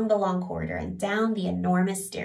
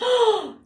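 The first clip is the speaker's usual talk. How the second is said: gasp; 7 dB louder than usual. Acted. King shocked by laughter.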